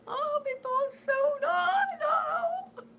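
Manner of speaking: breaking down with emotion mid-sentence; crying. Real, not acted. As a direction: voice cracking high into real tears. Overcome thinking of the baby.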